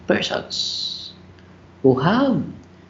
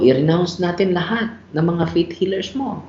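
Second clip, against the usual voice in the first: ordinary talk, nothing marked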